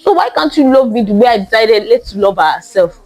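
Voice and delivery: normal talking voice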